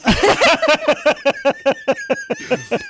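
long high laugh; high, helpless cackling at own story; laughter. Real, not acted. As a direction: burst out in high-pitched laughter. Laughing at his own retold gaffe.

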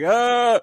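high stretching yawn groan; yawning stretch sound. Retold or imitated. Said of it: "you do that yawn stretch".

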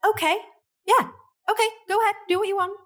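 high-pitched, mock-compliant guy; reluctant mock agreement. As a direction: imitate a man agreeing while clearly disagreeing. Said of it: "It's like they don't agree with it".

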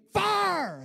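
high, slow shouted "fire" like a revivalist; mock fiery evangelist yell; revival preacher shouting. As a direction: hammer each word at the congregation. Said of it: "And he gets to me".

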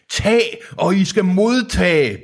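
high, slow proclamation; theatrical high-pitched bellow; roared. A narrator's reading. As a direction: roar it out like a preacher, drawn out. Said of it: "brølede Deacon".

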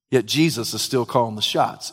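usual speaking voice

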